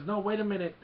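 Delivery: lightly acted cry to hold on; mock alarmed protest. Acted protest before being blasted.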